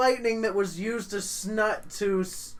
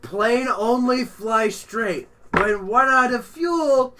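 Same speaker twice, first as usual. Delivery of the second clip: slow, louder broken caveman speech; simple-minded pilot; 5 semitones higher, 5 dB louder, slower than usual. Acted. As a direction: speak it slow like a dim brute.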